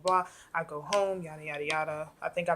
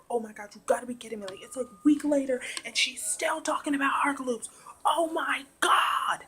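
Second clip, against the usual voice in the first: re-enacted screaming; 8 semitones higher, 5 dB louder than usual. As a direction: squeal in thrilled disbelief, getting louder. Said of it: "I'm, like, screaming".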